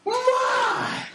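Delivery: very high, hammed-up cooing exclamation; gushing woman. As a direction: coo in mock amazement at a child. Gushing woman looks down at boy.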